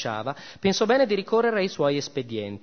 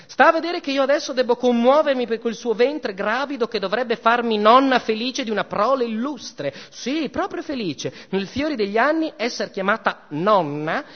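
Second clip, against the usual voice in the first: scornful goddess; 5 semitones higher than usual. Acted. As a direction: sneer and threaten like a cruel queen. Venus mocks and threatens Psyche.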